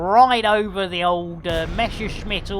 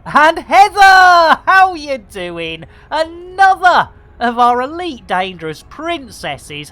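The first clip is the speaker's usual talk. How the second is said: big excited greeting; 8 semitones higher, 10 dB louder than usual. Real, not acted. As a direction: hail a newcomer like a show host. Hails Heather arriving in stream.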